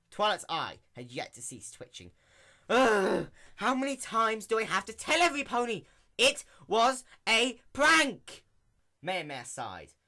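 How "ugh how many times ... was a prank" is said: high, loud exasperated cry; exasperated outburst. Acted. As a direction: throw up your hands and yell. Twilight exasperated at the mayor.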